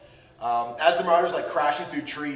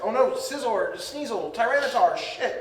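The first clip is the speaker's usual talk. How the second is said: higher, panicked villain voice listing lost Pokemon; voicing the villain; 7 semitones higher than usual. Villain realizing his Pokemon are gone, higher mock panic. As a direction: cry out in mock dismay, pitched up. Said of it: "but he's like".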